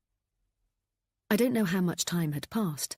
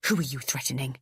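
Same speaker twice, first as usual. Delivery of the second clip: hissed; 3 semitones lower than usual. A narrator's reading. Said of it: "Adam hissed".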